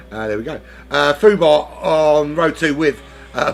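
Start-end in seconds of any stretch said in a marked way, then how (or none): none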